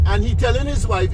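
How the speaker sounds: normal talking voice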